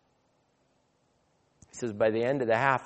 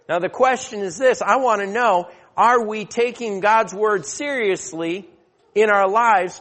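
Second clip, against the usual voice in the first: raised, louder pulpit preaching; preacher warning his flock to flee temptation, raised and forceful; 6 semitones higher, 4 dB louder than usual. Acted. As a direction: drive the point home to the congregation. Pastor returns to full preaching.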